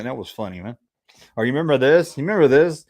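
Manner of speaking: usual speaking voice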